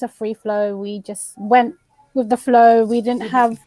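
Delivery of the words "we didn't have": ordinary delivery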